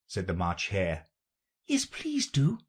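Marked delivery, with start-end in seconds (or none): 1.6-2.6 high little pleading voice. A narrator's reading. Alice asks the Dormouse for a story, pleading. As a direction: beg politely, pitched right up. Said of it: "pleaded Alice".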